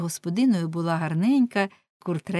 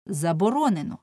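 usual speaking voice

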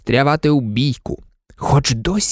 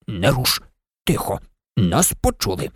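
lower, softer urgent fairy voice; fairy hushing in fear; 8 semitones lower, 4 dB quieter than usual. Acted. Fairy warns the maid in a low hush. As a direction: hold her still in a low hush.

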